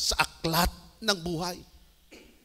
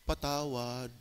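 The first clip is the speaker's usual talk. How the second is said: soft, low, repentant plea; humble prayer; 6 semitones lower, 9 dB quieter, slower than usual. Retold or imitated. Jong asking God's forgiveness in the prayer room, soft. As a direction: ask forgiveness quietly.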